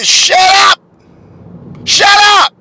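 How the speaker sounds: high, loud cry of disbelief; exasperated shout. Real, not acted. Podcaster shouting at preachers who use a put-on voice, loud and high. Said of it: "why are you doing that".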